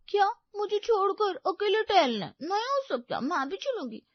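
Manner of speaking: high, pouty protest; clingy girlfriend. Acted. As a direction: refuse to be left behind, whining. Voices clingy Payal protesting.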